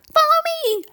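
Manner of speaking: very high, tiny fairy voice. Acted. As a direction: speak like a tiny glowing fairy. Fairy speaks.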